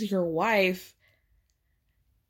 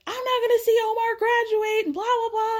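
imitating a scream, much higher; mock scream; 14 semitones higher than usual. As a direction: shriek it in a hammed-up impression. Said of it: "she's screaming".

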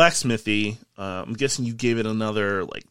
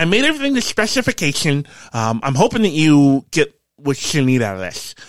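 high-pitched craftsman easing down toward normal pitch; Quentin, eager craftsman; 4 semitones higher, 6 dB louder than usual. Acted. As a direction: present your work in a high character voice. Game master plays Quentin.

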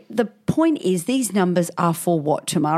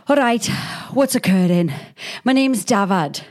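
lilting mock Welsh caller, a touch higher; mock Welsh accent; 4 semitones higher than usual. Man ringing emergency services, in a mock Welsh accent. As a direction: play the Welsh kebab caller in a hammed-up Welsh lilt.

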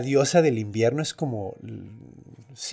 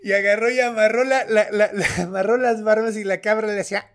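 ordinary talk, nothing marked

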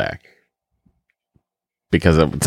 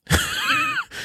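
burst of laughter; 7 dB quieter than usual. Real, not acted. Cracks up mid-read.

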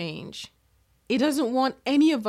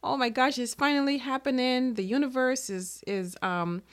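retold excitement, pitched higher; so excited; 4 semitones higher than usual. Speaker recalling her first speaking invitation, acting excited. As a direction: relive the thrill of great news. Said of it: "And I was so excited".

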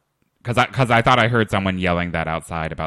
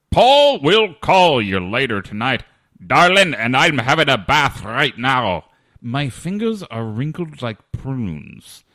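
put-on loud, slow sliding from Texas to Scottish to English accent; rolling through Texas, Scottish and English accents, high and loud; accent medley; 6 semitones higher, 8 dB louder, slower than usual. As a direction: ham up the tagline, hopping from Texas to Scottish to English. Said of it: "starting with a Texas accent, moving to a Scottish accent, ending with an English accent".

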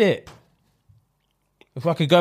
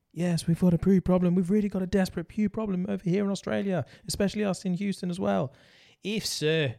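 mock spaceflight ad announcer, soft and unhurried; lightly hammed-up ad read; 6 dB quieter than usual. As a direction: read the sponsor spot like a mission control broadcast. Reads a Manscaped ad.

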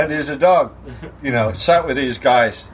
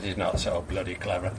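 low, soft, quick grumble; dismissive landlord; 10 semitones lower, 12 dB quieter, faster than usual. Acted. Landlord answers the gushing customer, dismissive. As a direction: toss it off low and unimpressed.

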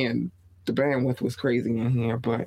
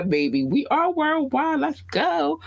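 hyped up; 11 semitones higher, slower than usual. Real, not acted. Hype shout-out to Craig.

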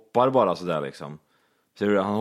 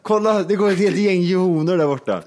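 laughing excitement; 8 semitones higher, 5 dB louder than usual. Real, not acted. Mocking a made-up plural.

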